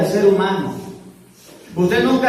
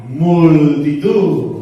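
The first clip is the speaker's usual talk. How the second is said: very slow, lower otherworldly growl of a name; demon voice; 4 semitones lower, 4 dB louder, much slower than usual. Retold or imitated. The demon telling Jesus its name, slow and eerie.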